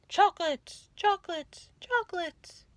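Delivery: high, fading far-off echo; echo from the cave. A narrator's reading. The cave echoes the rat's greedy question. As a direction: let each repeat die away. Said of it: "the voice from the cave replied".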